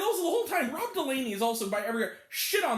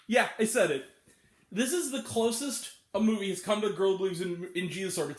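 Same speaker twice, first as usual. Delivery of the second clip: ordinary talk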